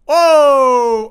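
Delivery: long, loud, high "whoa"; startled shout. Real, not acted. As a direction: cry out as you are flung. Aniden fırlatılınca bağırıyor. (uzun, şaşkın bağırış)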